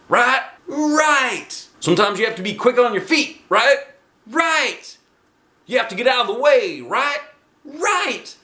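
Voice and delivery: put-on high coach prompts and high kids' answers; call and response. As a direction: bounce it back and forth. Teacher and class call-and-response.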